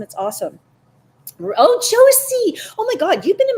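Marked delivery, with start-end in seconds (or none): 1.4-3.6 delighted greeting. Real, not acted. Greets viewer Josie.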